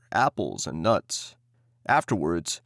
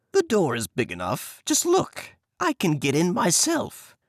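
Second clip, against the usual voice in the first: show-and-tell witch voice; impatient witch; 7 semitones higher than usual. Acted. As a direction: demonstrate with scorn. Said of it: "said the old woman".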